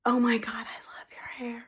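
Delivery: whispered quote. Retold or imitated. Retelling what a lady said about her hair, whispered. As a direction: pass it on as a hushed secret.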